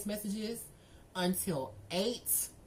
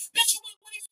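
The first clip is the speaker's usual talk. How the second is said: much higher, incredulous mock; 14 semitones higher than usual. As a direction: squeak it up in disbelief. Mocking his claim.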